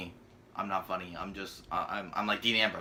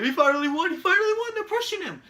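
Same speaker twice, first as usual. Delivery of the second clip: much higher, louder squeaky mocking voice; mocking fans; 6 dB louder than usual. Reviewer mocking fans cheering Wyatt's win, squeaky high parody voice. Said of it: "I already know what you people are saying".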